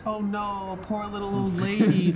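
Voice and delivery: high, put-on pitying voice; mock sympathy. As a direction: coo over a poor old lady. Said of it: "prepared for them to be like".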